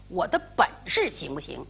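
ordinary delivery, nothing marked

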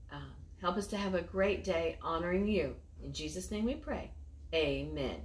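gentle, measured prayer voice; quiet class prayer. Acted. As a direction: pray simply for the children. Leads children in a prayer.

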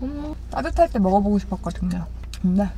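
chewing while talking; speaking between bites; mouth partly full. Real, not acted. Seen 5: Bites bread at -3/-2, lips pressed, chewing while speaking early on.

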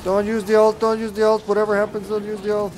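high, insistent repeated warning; urgent warning. Real, not acted. Gamer warning teammates off the ult, urgent high plea.

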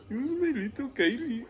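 high, put-on Indian accent. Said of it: "the Indian voice".

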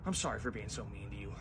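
quiet, humbled sorry; ex-bully quietly apologising to the chorus kid. Acted.

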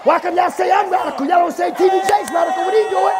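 high, loud challenge to the church; shouted preaching. Real, not acted. Preacher protests being called radical, high shouted challenge. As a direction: shout it as an indignant defense. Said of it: "shout the same way".

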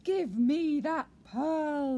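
very loud, slow menacing demand; fierce eel. Acted. Fierce eel demanding the pearl, loud menacing voice. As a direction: thunder it out slowly, each word heavy.

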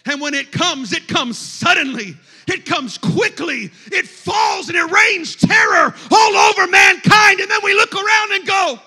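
preacher climbing to a high, loud shout; mounting pulpit fervour; forceful preaching. Acted. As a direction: build the warning into a full-force cry. Preaching, building.

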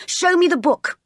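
high-pitched imperious order; bossy young master. Acted. John demanding the book from Jane, bossy. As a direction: order her about like a spoiled boy.

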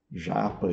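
"Japa" as ZHAH-pa; soft Portuguese J and open A in "Japa"; nonexistent sound. Retold or imitated. Teacher singles out the mistake, zh sound in "Japa". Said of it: "esse som nem existe em sânscrito".

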